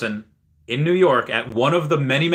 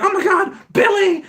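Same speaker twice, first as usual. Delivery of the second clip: high, alarmed cartoon scream; panicked mom fish; 5 dB louder than usual. Streamer voicing mom fish finding Billy flopping, panicked mock shriek. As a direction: shriek the name like a horrified cartoon mother. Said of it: "the mom fish comes in".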